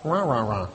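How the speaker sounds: low grumbling noise; imitated grumbling. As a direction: drop into a mock grumble. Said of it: "That's what it sounds like".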